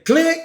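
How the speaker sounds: high, sharp click sound; a squeaky imitated igniter click; mock click. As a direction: pop the click up high like a lighter. Mimicking the grill igniter.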